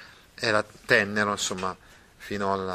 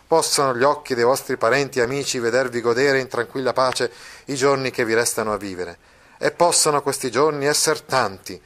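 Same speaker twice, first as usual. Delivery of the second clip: declaims the farewell to the lovers; story narration; 3 semitones higher, 3 dB louder than usual. Acted. Reading the narrator's blessing. (narrazione letta con enfasi)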